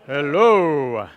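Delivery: low hello with a laugh; deep hello for Joseph. Shown as a demonstration. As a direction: drop into a low man's greeting. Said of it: "we say".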